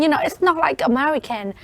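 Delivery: usual speaking voice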